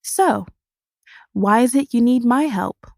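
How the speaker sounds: bright forced smile. A narrator's reading. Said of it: "I scare him with a wide smile as I say".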